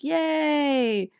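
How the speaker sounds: high, drawn-out "yay"; put-on celebratory squeal; mock happy cheer. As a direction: imitate a jolly crowd cheering in song. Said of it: "happy, happy song, and they're singing".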